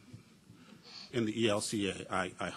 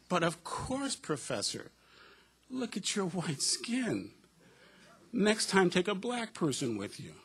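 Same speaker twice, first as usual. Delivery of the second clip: higher, amused student voice; student's voice; 8 semitones higher, slower than usual. Retold or imitated. Student advising her professor, in a higher, amused voice. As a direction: lift up into the student's matter-of-fact voice.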